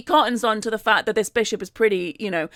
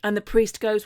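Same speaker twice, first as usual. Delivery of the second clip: plain reading voice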